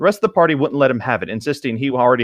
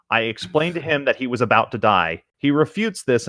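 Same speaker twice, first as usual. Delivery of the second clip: usual speaking voice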